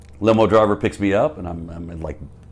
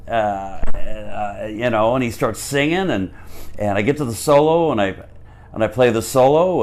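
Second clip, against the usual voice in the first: ordinary talk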